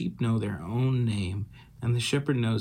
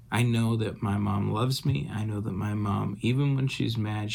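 ordinary talk, nothing marked

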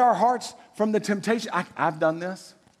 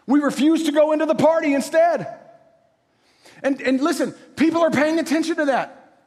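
louder, higher preacher push; fervent preaching; 7 semitones higher, 4 dB louder than usual. Acted. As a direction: drive the point home to the congregation. Preaching builds to full force.

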